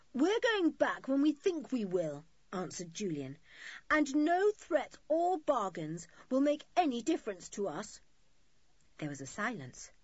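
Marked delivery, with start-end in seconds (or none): 0.1-2.3 put-on raised, loud defiant boy; defiant retort. A narrator's reading. Julian refuses the woman's bargain, defiant. Defiant answer in acted quarrel. 3.9-8.0 loud, high refusal; defiant retort. A narrator's reading. Julian dismisses threats and bargains, defiant. Defiant answer in acted quarrel.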